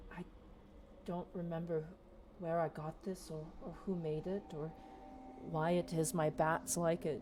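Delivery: soft, puzzled giantess; quiet musing. Acted. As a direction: wonder aloud softly about the flute.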